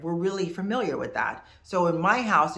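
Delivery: normal talking voice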